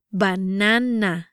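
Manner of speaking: "Banana" slowly, syllable by syllable; "Banana" drawn out with open Spanish a sounds; slow pronunciation demo. Shown as a demonstration. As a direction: stretch out "Banana" for learners. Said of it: "try pronouncing these words".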